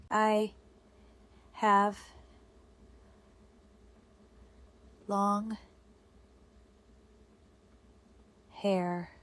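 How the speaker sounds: one word at a time, long pauses; dictating. Shown as a demonstration. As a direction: give writers time for each word. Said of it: "This dictation follows three steps".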